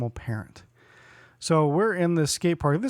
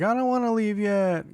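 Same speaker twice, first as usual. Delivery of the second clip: put-on higher, whining child's voice; whiny kid; 6 semitones higher than usual. Imitating the birthday boy not wanting to leave. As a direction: beg to stay like a little kid. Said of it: "her son's like".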